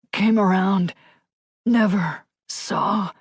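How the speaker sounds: slow, fading wounded voice; a hint of a dying man; faint, dying. A narrator's reading. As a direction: drag the words out with little breath left. Dying man's faint words.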